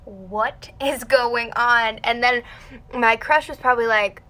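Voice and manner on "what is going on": slow, louder mock confusion; stretched-out baffled question; bewildered. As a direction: draw out each word in disbelief. Acts out sitting there bewildered.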